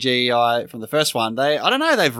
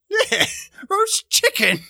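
mock delight; slower than usual. Mocking Sam's roast chicken seasoning.

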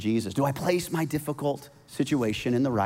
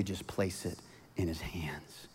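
breathy soft whisper; 8 semitones lower, 14 dB quieter than usual. Real, not acted. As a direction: whisper it intimately. Sermon falls to a whisper.

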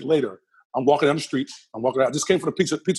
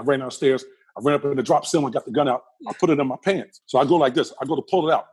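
ordinary delivery

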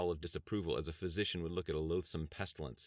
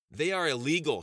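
higher, louder sudden outburst; blurted out; 9 semitones higher, 8 dB louder than usual. Acted. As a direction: blurt it out before thinking. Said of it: "she blurted out".

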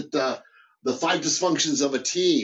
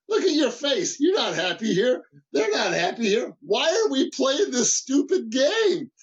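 high-pitched, incredulous challenge; exasperated confrontation; 10 semitones higher than usual. Retold or imitated. Manager confronting an unhappy worker about pretending, acted exasperation. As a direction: call them out, pitched well up.

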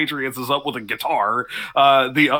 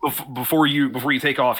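ordinary talk, nothing marked

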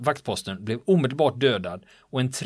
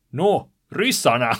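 loud, slow, exasperated shout; the soldier shouting at the lieutenant that it's the Russians; 3 semitones higher, 7 dB louder, slower than usual. Retold or imitated. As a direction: bellow it like it's obvious. Soldier answers lieutenant urgently.

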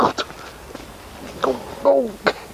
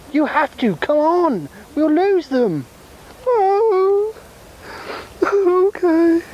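high small voice pushing others on; high-pitched squeaky voice; 10 semitones higher than usual. Acted. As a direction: squeak at them to keep moving.

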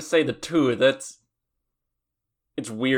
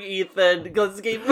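laughing; 9 semitones higher, 6 dB louder than usual. Real, not acted. Laughing while protesting the teasing.

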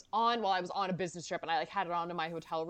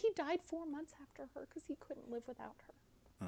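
tearlets starting; 9 semitones higher than usual. Real, not acted. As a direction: squeeze it out thinly, on the verge of tears. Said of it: "Okay, the tearlets are starting".

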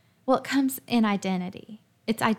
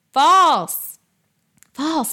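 loud, sharp verdict, higher; emphatic shout; 5 semitones higher, 9 dB louder than usual. Real, not acted. Speaker rejects her old belief with a loud cry. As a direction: slam the word down.

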